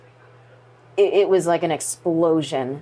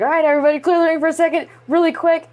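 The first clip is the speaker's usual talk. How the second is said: much higher, very fast, louder call to clear the ring; imitating Dwayne; 11 semitones higher, 5 dB louder, faster than usual. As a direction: rattle it off like a boss clearing the floor.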